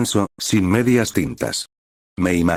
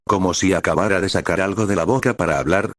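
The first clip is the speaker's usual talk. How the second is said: plain reading voice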